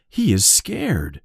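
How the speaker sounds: usual speaking voice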